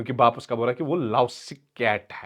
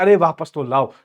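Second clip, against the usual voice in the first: ordinary delivery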